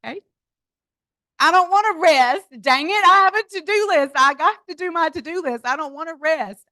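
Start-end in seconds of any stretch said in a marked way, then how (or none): 1.4-6.7 protest. Retold or imitated. Protesting being told to rest.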